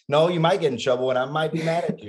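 hammed-up scolding parent, half laughing at it; mock stern parent. Parent mocking the old way of scolding a child, mock stern and fast. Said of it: "you pretty much think, like".

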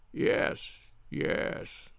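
barely voiced sickbed whisper; feeble whisper. Sick man answering his tormentor, feeble whisper. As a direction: breathe it out very softly, as if failing.

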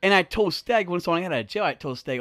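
usual speaking voice

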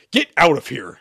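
loud, brisk dismissal; sharp order. A narrator's reading. Exorcist sending Shepard from the room, sharp order. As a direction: order them out firmly. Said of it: "Sherman ordered".

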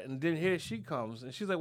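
ordinary talk

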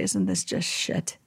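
soft bitter whisper. Retold or imitated. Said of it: "She'd say".

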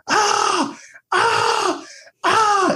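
high-pitched mock screams; repeated shrieks at a contained volume, imitating a meltdown; imitated scream. Host imitating a student who snaps while cramming, mock screaming. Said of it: "starts yelling at the top of his lungs".

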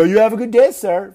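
loud, high mock-polite send-off; very sarcastic. Ticketing cop to annoyed driver, sarcastic. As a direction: lay the fake courtesy on loud and high. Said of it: "very sarcastically".

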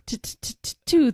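playfully stuck on the first consonant; mock stutter. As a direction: stammer on the opening T. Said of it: "we were doing the stuttering".

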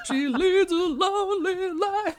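high, slow, mournful falsetto; hammed-up lonely high voice; high mock lament. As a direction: lament the eel's fate in a high thin voice. Mock sad eel commentary.

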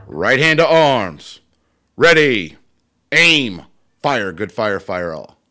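loud barked military toast commands; barked firing squad commands. Shown as a demonstration. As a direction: bark each command like a drill leader. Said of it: "I'm gonna recite it anyway".

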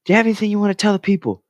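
high, fast put-on interviewer voice; reporter voice. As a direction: chirp it like an eager sideline reporter. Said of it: "They go".

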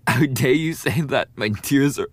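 loud, high, crying outrage; tearful yelling. Acted. Rejected bully yells tearfully at the victim. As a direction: scream through the tears.

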